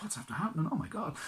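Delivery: horrified at the chaos; alarmed. Acted. Prince sees castle in panic.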